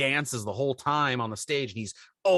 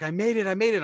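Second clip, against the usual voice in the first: out of breath; 6 semitones higher, 4 dB louder, faster than usual. Retold or imitated. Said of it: "I'm out of breath".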